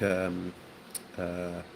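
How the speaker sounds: ordinary talk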